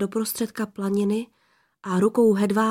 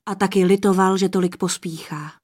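ordinary delivery, nothing marked

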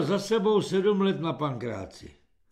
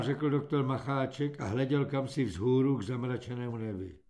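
ordinary delivery, nothing marked

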